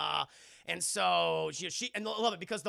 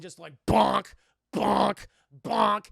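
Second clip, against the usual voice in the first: loud bonk sounds; 10 dB louder, slower than usual. Retold or imitated. Imitating head hitting dresser.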